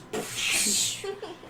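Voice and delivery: unvoiced whoosh of spray; spraying water noise. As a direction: imitate a jet of water with the mouth.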